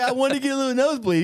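high, loud, rushed mock excuse; Jeremy. Buddy claims he wanted the nosebleed, high-pitched imitation. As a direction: rattle it off in a squeaky buddy voice. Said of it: "like, higher pitch".

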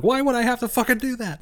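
high, indignant disbelief; incredulous, high-pitched. Retold or imitated. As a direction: squeak it out, baffled. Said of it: "I love his reaction to that".

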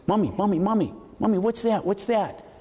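put-on high, eager child tugging at mom; excited little kid. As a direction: pipe up high like a curious boy. Boy voiced calling his mother.

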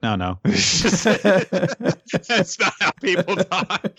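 laughing high through the words; giggling, exasperated. Retold or imitated. Amused fan laughing at anime dialogue. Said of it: "I'm like".

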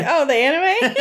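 high, laughing mock-innocent question; naive guessing. Imitating his second wrong guess about Avatar, naively hopeful.

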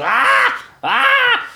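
high shrieking yelps of panic; panicked creature shrieks. Acted. Burning bugbear panics as his armor flames, shrieking high. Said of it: "he's, like, freaking out".

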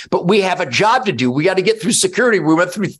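normal talking voice

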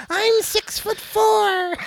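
very high, slow squeal; little high squealy voice. Retold or imitated. Kid brags to dad about his height, high squealy voice. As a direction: imitate a kid boasting in a drawn-out squeak. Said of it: "he said it in that little high squealy voice".

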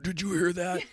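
high, hammed-up ghost-show hype; imitating an overexcited ghost-show host; mock TV producer. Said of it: "They're like".

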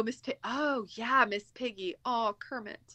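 usual speaking voice

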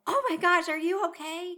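high, loud panicked concern; alarmed gasp. Retold or imitated. Checking on her injured partner, high alarmed cry. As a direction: gasp it out to someone who just fell. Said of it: "and I'm like".

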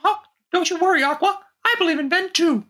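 squeaky-high loyal mouse friend; high cartoon mouse voice.

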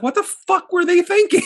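incredulous. Real, not acted. Disbelief at the offensive accent.

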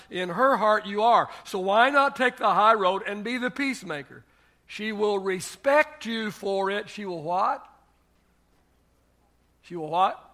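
loud, high, emphatic preacher delivery; forceful preaching. Acted. Preacher urging husbands to own their mistakes, forceful. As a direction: drive it home from the pulpit.

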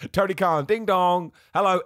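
high, loud mock swoon over Tony Khan. Mocking a fan who swoons over Tony Khan, loud and high. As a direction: gush like a smitten fan.

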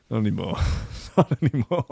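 laughing through it, rueful; laughing self-mockery. Real, not acted. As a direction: laugh at yourself.

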